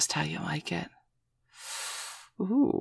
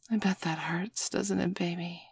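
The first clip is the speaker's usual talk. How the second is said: whispered tease; 3 semitones higher, 6 dB quieter than usual. Acted. Whispered mock pity.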